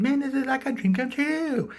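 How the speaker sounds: very high, gushing excitement; hammed-up thrilled squeal; mock delight. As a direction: gush like an overjoyed guest. Acts out delighted invited guest.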